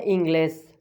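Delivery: इंग्लिश said "een-GLES"; Spanish example word. Shown as a demonstration. Teacher gives example word, इंग्लिश as Spanish "ingles". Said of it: "ई से क्या बनता है?".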